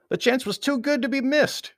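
high, loud climactic narration; excited narration. A narrator's reading. Narrator reaching the fight's climax, high and excited. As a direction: lift pitch and volume with relish.

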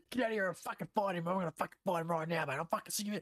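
high, strained rant of an Aussie bogan dad; hammed-up furious bogan tirade; mock angry dad. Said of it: "Peter is angry because".

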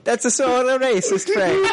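high, laughing fake-Italian accent; mock Italian accent. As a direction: ham it up as a cartoon Italian. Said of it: "we've made fun of Italy".

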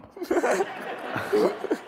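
high, airless wheezing laugh; breathy laugh. Real, not acted. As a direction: let a silent wheeze of laughter escape. Laughing at Lottie memory.

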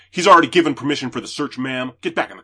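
fast, loud, commanding officer; loud cop order. Retold or imitated. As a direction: bark the order at her. Cop orders woman back.

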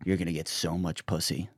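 low, hushed cop into your ear; confidential murmur. Retold or imitated. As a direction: imitate a cop's private aside, soft and low. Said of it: "the cop whispers in my ear".

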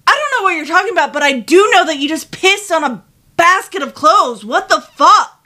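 fast, loud, exasperated rant; angry rant. Retold or imitated. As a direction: rattle it off, fed up. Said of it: "I said".

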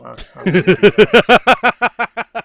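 drawn-out mock innocence; slow, loud, exaggerated innocent denial; fake innocent denial. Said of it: "the sound of a Canadian lying through his teeth".

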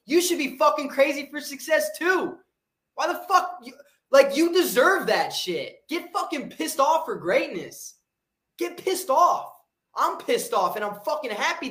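rally the viewers, get them fired up; high-pitched pep-talk surge; fired-up rant. Real, not acted. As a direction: pitch jumps high on a pumped-up motivational push.

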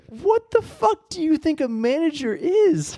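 high, slow, incredulous squeal; high-pitched drawn-out disbelief; exasperated mock outburst. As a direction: act baffled at the idea of a manager. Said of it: "I'm like".

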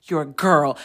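a low, firm imitation of the little girl; bossy kid. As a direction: lay down the law like a tiny boss. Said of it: "she's like".

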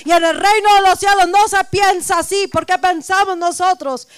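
loud, driving preacher's cry; full-force preaching. Acted. Pastor rousing her congregation, full-force preaching.

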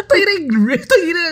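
very high, squealing laugh; hysterical laughter. Real, not acted. Friend cracking up at the taho tumbler joke. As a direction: lose it completely laughing.

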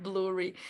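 blueberry softly copied as "BLOO-ree"; imitated swallowed word. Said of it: "We sort of swallow the word".

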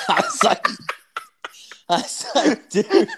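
cracking up, high and loud; high-pitched laughing retelling. Real, not acted. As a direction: barely get the words out through laughter. Cracking up retelling the hater's insult.

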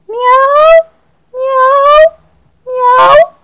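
very high, loud insistent meows; energetic meowing. Acted. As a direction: meow hard in protest. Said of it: "maulló el gato enérgicamente".

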